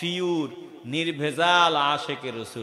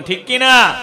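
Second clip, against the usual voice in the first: loud, high demand for an answer; rousing call; 9 semitones higher, 14 dB louder than usual. Acted. Preacher to the gathering, loud call for a reply. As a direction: punch it out to stir the crowd.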